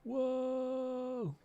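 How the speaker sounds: high, sudden gasp of surprise; surprised whoa. Real, not acted. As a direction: caught off guard by the ride. Surprise at the game ride.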